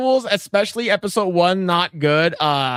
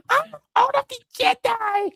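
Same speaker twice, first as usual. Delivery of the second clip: very high squeaky voice; mock heroic line. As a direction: parody the heroine. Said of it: "mocking Rey line".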